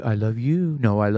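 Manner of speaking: drawn-out sweet talk; slow lovey-dovey voice. Pretend. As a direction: coo it slowly to a sweetheart. Playing lovey phone call.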